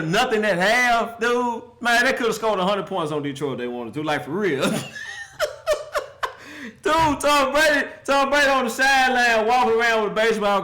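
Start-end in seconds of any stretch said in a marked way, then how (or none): 4.7-6.3 high squealing laugh. Real, not acted. Laughing at Detroit.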